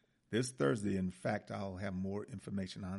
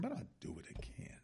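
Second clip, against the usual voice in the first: whispered aside; 9 semitones higher, 8 dB quieter than usual. Real, not acted. Aside while reading.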